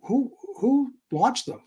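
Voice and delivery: high, slow, baffled asking; puzzled question. Retold or imitated. Retelling how he asked the agents, baffled and slowed. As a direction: stretch it out in disbelief.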